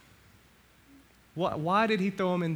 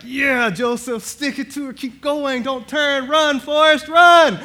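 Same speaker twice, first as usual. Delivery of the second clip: loud, rising cheer urging Joseph to keep running; climbing from eager to a full shouted cheer; cheering him on; 10 semitones higher, 11 dB louder, slower than usual. Retold or imitated. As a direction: yell him on like a crowd at a race. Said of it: "you're like".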